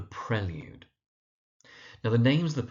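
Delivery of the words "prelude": Prelude said PREL-yood; correct pronunciation. Shown as a demonstration. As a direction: model Prelude with short "e", then "yood". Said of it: "but the poem is called".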